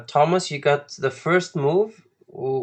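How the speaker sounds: ordinary talk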